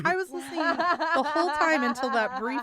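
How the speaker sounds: talking through laughter; high, loud, laugh every word. Real, not acted. Laughing while defending self.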